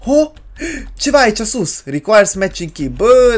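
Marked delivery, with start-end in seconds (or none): none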